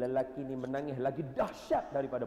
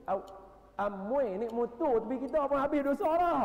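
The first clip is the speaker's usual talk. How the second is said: comic exclamation; 12 semitones higher, 7 dB louder than usual. Real, not acted. Preacher joking to the men about a girl on a motorbike. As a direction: tease the crowd about their weak faith.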